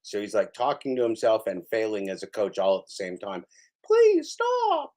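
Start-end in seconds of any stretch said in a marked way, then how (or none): 3.9-4.9 high squeaky pleading whine; mock whine. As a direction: beg in a tiny squeaky voice. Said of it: "what he should say to himself".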